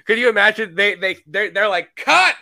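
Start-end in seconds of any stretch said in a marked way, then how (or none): 2.0-2.3 high, loud call of cut; mock shout. Director stopping the take, loud mock shout.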